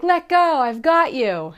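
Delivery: high, loud urging; belayer calling up. Pretend. Belayer reassuring the nervous climber, high loud call. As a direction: shout encouragement up the wall.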